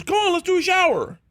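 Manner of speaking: high-pitched retold invitation; imitated eager urging. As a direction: urge a friend along, acting it out. Snoop's eager invitation acted out.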